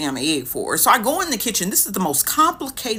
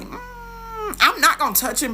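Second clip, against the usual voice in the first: squeamish refusal. Retold or imitated. Refusing to help fainted man.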